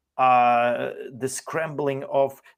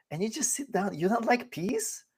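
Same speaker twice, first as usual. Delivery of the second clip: mocking advice; 7 semitones higher than usual.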